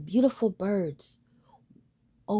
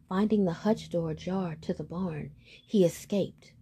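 ordinary delivery, nothing marked

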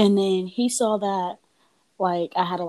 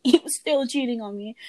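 higher, laughing in disbelief; 5 semitones higher than usual. Real, not acted. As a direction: laugh it out, incredulous. Repeats the line, laughing.

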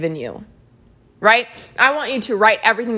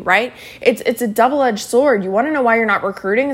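ordinary talk, nothing marked

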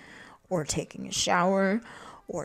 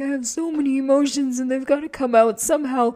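high, loud, hammed-up outburst; imitating emotional overwhelm; 8 semitones higher, 7 dB louder than usual. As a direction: burst out as a character flooded with feelings. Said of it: "feeling a lot of mixed emotions".

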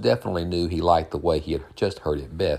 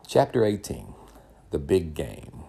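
title announcement. A narrator's reading. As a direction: present the chapter title, lifted and clear.

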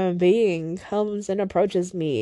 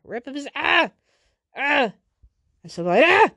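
frustrated cries; 4 semitones higher than usual. Real, not acted. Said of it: "I'm so mad".

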